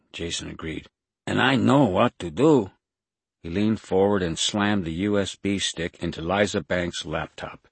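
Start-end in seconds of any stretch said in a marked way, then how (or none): 1.3-2.7 loud, raised put-on Mexican accent; imitated Mexican accent. A narrator's reading. Impostor fooling the woman with the gun, loud Mexican accent. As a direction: announce it boldly in a faked Mexican accent. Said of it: "tried to duplicate it".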